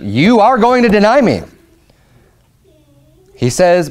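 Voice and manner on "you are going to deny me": higher-pitched, emphatic retold pronouncement; firm warning. Jesus telling Peter he will deny him, firm warning. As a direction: state it as certain, pitch raised then falling. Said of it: "not just a warning, but tells him".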